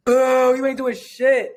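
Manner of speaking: loud drawn-out boo, then dismissive jeer; mock heckling crowd. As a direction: heckle like a disgruntled voter. Imitates a heckling public.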